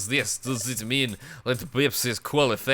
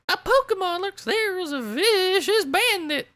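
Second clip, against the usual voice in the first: cheerful high-pitched guild leader; bright childlike head voice; high guildmaster voice. Acted. Guildmaster character voice.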